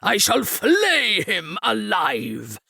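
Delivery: slow, drawn-out outraged oath; furious vow. A narrator's reading. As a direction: swear vengeance, stretching every word. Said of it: "he swore".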